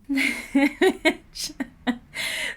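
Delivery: long, high, helpless laugh; real burst of laughter. Real, not acted. Host cracking up at how savage the diss was, laughing. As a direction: crack up out loud. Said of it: "Sorry, I'm laughing".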